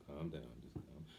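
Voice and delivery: low, very soft voice; quiet, low retold soothing; mom's calming. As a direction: hush him like a calm mother. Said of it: "that's how she talked to me".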